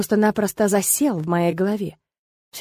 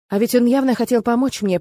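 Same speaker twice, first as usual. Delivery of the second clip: ordinary delivery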